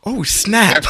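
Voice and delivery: high, loud burst of excitement; excited exclamation. Retold or imitated. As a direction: jump up high and loud, thrilled. Excited reaction to Rex invitation.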